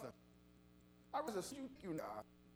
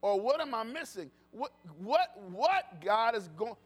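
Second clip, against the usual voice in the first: loud, rising gospel preaching, driving each line; full-force preaching; 4 semitones higher, 10 dB louder than usual. Acted. Pastor urging the congregation to stay in church, loud preaching. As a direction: preach it out to the back pews.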